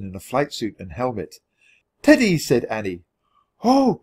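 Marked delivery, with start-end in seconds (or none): 2.0-2.4 high, loud joyful call; excited cry. Acted. Annie spotting Teddy by the plane, a high joyful cry. 3.6-4.0 high, loud gasp of joy; surprised cry. Acted. Kathleen seeing Teddy at last, a high joyful cry. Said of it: "cried Kathleen".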